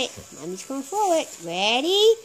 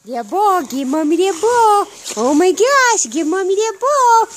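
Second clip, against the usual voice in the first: rising pet talk; 6 semitones higher, 4 dB louder than usual. Real, not acted. Coaxing dog to give ball.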